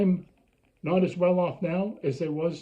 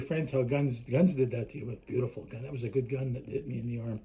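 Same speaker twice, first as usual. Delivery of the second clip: much softer, lower mock bragging; mock gun pride; 7 semitones lower, 6 dB quieter than usual. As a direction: sneer it quietly, like a proud victim. Mocks a gun lover bragging.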